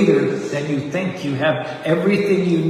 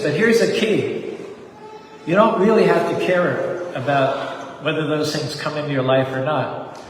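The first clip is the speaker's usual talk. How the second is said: normal talking voice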